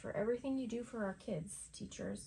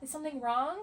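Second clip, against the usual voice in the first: mock alarmed concern, pitched higher; worried coworker; 6 semitones higher than usual. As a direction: play the fussing colleague, a bit hammed-up. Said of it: "they're like".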